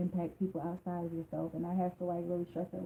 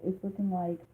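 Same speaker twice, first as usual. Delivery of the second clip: normal talking voice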